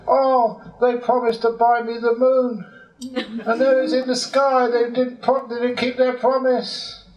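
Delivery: very high, whining mock wail; mock crying. Actor crying over the promised moon, high mock wailing. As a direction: complain tearfully like a let-down child. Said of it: "that's why I'm crying".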